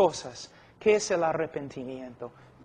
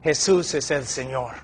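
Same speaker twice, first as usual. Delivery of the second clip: firm confession; 3 semitones lower than usual. Acted. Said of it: "y él dice".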